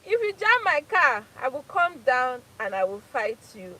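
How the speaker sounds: hammed-up road-rage threat; mock shout. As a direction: bark the threat like a fighter. Repeats the threat louder.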